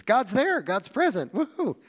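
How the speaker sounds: high, loud giddy cheer; mock elation. Preacher voicing Israelites in good times, mock elated. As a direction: celebrate with a hammed-up whoop at the end. Acting out good-circumstances reasoning.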